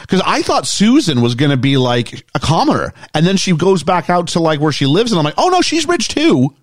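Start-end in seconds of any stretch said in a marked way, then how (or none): 5.4-6.5 high-pitched retold surprise; mock dismay. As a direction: replay a sudden realisation, pitched high. Acting own reaction to film.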